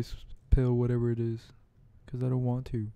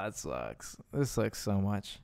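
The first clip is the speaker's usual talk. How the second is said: deflated groan; 4 semitones lower, 12 dB quieter, slower than usual. Real, not acted. Pokemon fainted.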